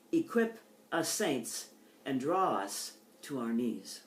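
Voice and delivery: deliberate reading, the opening words hit hard; poem reading. Acted. Poet reading her poem on her husband's drawing, deliberate and weighted. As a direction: lean into the clipped verbs, then let it sink.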